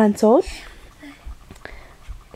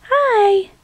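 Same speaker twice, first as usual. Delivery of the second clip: put-on baby voice; 8 semitones higher than usual. Voicing baby's hello.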